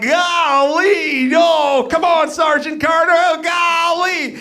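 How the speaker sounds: Jim Nabors as Gomer Pyle. Retold or imitated. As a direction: play the gee-whiz bumpkin pleading with the sergeant. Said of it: "Jim Nabors would be like".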